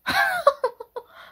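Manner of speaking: high loud cackle; high burst of laughter. Real, not acted. Reactor cracking up at her own mimicry, high laugh.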